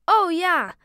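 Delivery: high, loud eager cut-in; eager interruption. A narrator's reading. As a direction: jump into the conversation. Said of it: "fiel mir Brian allerdings ins Wort".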